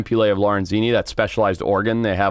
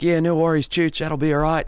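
higher, put-on Australian accent; 6 semitones higher than usual. Producer quoting Andrew's easygoing reply in an Australian accent. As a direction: imitate a laid-back Australian mate. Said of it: "That's my best Australian".

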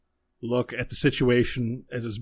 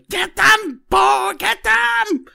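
high, loud screamed command; desperate yell; 12 dB louder, much slower than usual. Acted. Fleeing woman screams at Bo to attack, high and loud. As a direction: scream for help while running away. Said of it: "she just manages to yell".

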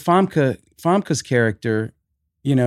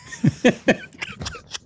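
high laugh. Real, not acted. Reacting to the whispered line.

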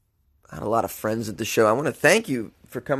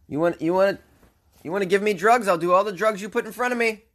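reckless party shout; 8 semitones higher than usual. Retold or imitated. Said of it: "last night I said".

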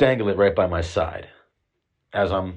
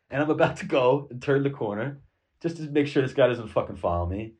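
ordinary talk, nothing marked

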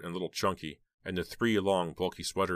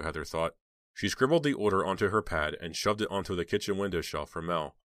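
ordinary delivery

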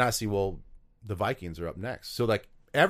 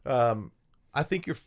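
ordinary talk, nothing marked

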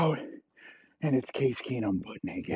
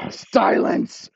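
sharp loud hissed command; commanding hush; 12 semitones higher, 6 dB louder than usual. Acted. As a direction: cut him off with a hissed order. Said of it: "And he's like".

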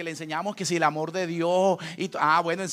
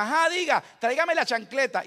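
louder, higher exchange; impatient call; 6 semitones higher, 5 dB louder than usual. Retold or imitated. Mother answers and father demands the slipper, loud. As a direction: raise it up, impatient.

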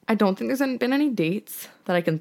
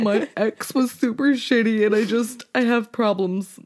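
mock emotional; 2 semitones higher than usual. Teasing friend plays her crying on the date, mock emotional. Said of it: "I was really emotional".

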